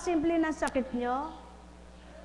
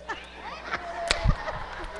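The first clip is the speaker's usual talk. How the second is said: laughing; 12 semitones higher than usual. Real, not acted. Healer laughs with sick person.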